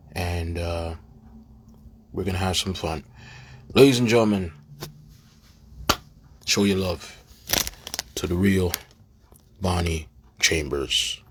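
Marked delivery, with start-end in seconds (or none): 3.7-11.2 announcer. Real, not acted. Host introduces guest to audience.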